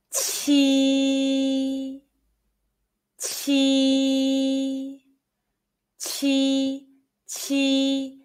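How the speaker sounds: "Qi" held out, high level tone; "Qi" repeated slowly, aspirated; Mandarin qi drill. Shown as a demonstration. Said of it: "repeat after it".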